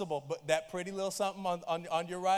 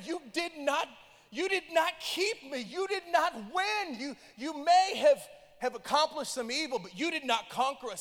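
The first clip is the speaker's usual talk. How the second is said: defiant survivor; 6 semitones higher, 3 dB louder than usual. Retold or imitated. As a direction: speak as the survivor facing his tormentor. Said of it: "he said, 'I dreamed of the day".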